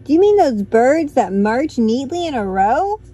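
loud cheery question. Acted. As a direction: boom it out cheerfully.

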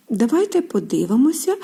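ordinary delivery

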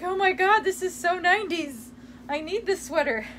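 high, gushing thrill over a sweater; excited gush. Retold or imitated. To her viewers about her sister's sweater, gushing excitedly. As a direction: squeal it like a fashion find. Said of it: "I'm like".

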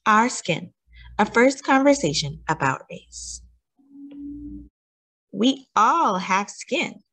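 put-on gentle picture-book read-aloud with questions to the child; a touch of storyteller warmth, mostly natural voice; reading to children. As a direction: read it like bedtime story time for a small listener.

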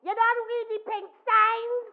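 high, loud and slow calling out; loud, high challenging question; soft loud voice. Retold or imitated. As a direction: call from the store doorway. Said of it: "in that soft, loud voice".

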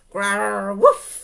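growl, then bark sharply; dog's growl bursting into a yap. A narrator's reading. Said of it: "said Honey".

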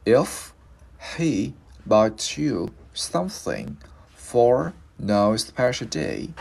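"If... he... bought you something..." with a pause after each piece; slow word by word. Shown as a demonstration. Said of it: "bây giờ mình lựa cái này".

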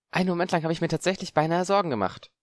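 put-on lightly relieved young Harry; a boy sighing off his worry. Harry voiced in the story.

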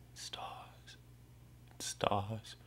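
barely whispered, voiceless repeat; hushed echo. Acted. Tormented man gazing at the stars, hushed echo. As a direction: let it fade to a whisper.

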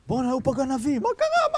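high-pitched acted panic; frantic outburst. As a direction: act out a father starting to rampage. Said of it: "התחיל להשתולל".

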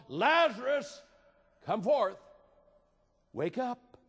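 high, loud summons to the dead; commanding call. Acted. Jesus calling Lazarus out of the grave, commanding. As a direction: ring it out as a command. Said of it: "Jesus called".

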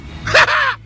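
a high, gleeful burst of laughter. Real, not acted. Laughing about finally being done paying alimony. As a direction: cackle with delight at the news.

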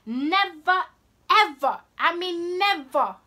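loud, high, emphatic read-out; reading capital letters. Acted. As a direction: punch each word like all caps. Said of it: "Someone wrote this in capital letters".

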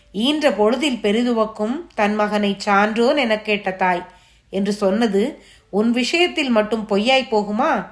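ordinary delivery, nothing marked

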